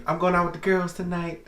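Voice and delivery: high girly voice, lightly put on; man doing a woman's voice. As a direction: squeak it like a girlfriend. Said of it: "That's my girl voice".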